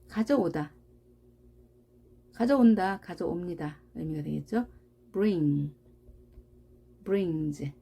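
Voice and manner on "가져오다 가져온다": ordinary talk, nothing marked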